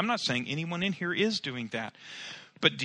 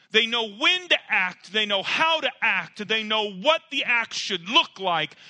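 loud, high, driving sermon voice; full-force preaching; 6 semitones higher, 6 dB louder than usual. Acted.

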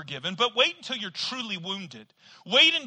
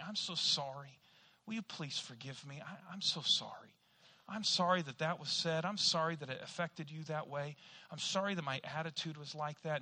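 soft apology; 4 semitones lower, 8 dB quieter than usual. Retold or imitated. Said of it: "you say".

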